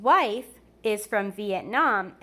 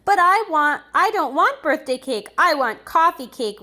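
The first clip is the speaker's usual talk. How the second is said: whiny demand; 5 semitones higher, 7 dB louder than usual. Acted. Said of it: "and he stomped his feet".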